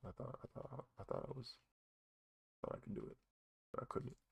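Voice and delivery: very soft, barely voiced admission; defeated murmur. Real, not acted. Speaker admitting they couldn't do it after all, very hushed. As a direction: let the voice almost vanish as you give up. Giving up, voice nearly gone.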